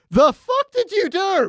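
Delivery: dumbfounded squeak from the big man; shocked Hodor. Retold or imitated. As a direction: gape at the blood on your own hands. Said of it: "He's like".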